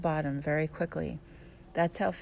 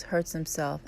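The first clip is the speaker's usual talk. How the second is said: ordinary delivery, nothing marked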